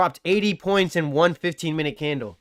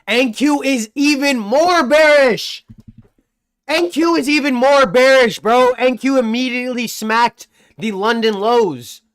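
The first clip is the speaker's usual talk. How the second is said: higher, louder, slowing for emphasis; excited market call; 8 semitones higher, 5 dB louder than usual. Real, not acted. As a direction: hype up the bearish call.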